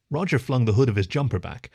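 ordinary delivery, nothing marked

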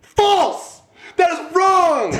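high triumphant shout of false; gloating buzzer call. Real, not acted. Friend shouting that the trivia answer is wrong, high and gleeful. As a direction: yell the verdict like a game-show buzzer.

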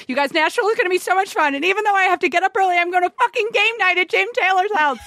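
cranked-up excitement. Retold or imitated. Cohost reads Arden's text aloud, over-the-top excited gushing. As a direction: gush it like the most excited text ever sent. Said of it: "I'd crank it to about eight, eight hundred ninety".

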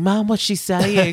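put-on slow, high, puzzled child; a posh child asking mum; puzzled posh kid. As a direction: kids asking mum about the nanny, puzzled.

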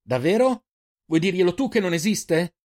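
high, loud mocking challenge; sarcastic retort. Eirin sneering back at the boy, loud and sarcastic. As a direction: throw it back at him.

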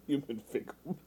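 a hint of mocking his deadpan; Lizard voice. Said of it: "the Lizard voice".

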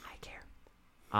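tiny whispered protest; very soft, voiceless interjection; whispered aside. Real, not acted. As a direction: whisper it meekly under the other's talk. Tiny aside after "no one cares.

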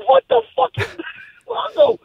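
high, disbelieving "what the fuck" at a friend; incredulous outburst. Retold or imitated. Telling the co-host about his friend's dagger necklace, high incredulous outburst. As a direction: react in total disbelief.

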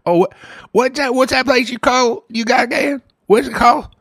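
mock Southern accent; put-on country accent. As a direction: play along in a country twang. Plays customer to Old Man Gaffney.

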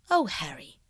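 ordinary delivery

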